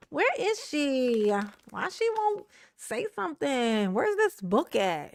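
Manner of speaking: slow, drawn-out complaint; mock pleading. Fan missing [name] every day, slow mock pleading. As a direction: drag the questions out like an impatient fan. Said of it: "Every day, I be like".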